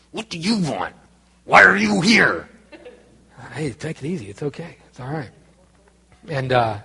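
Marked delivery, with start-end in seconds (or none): 0.1-2.5 mock angry teenager, louder on the second question; angry teen girl. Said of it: "she was so angry". 3.5-5.3 soft, gentle calming words; quiet reassurance to an upset teen; soothing. Retold or imitated. Calming the angry girl.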